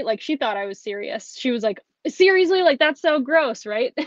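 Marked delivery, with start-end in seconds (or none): 2.1-4.0 mock grossed-out teen. Niece quoted, grossed out.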